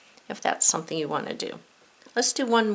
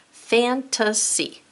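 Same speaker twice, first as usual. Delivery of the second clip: slow word reading; 4 semitones higher, much slower than usual. Shown as a demonstration. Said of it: "Write the word".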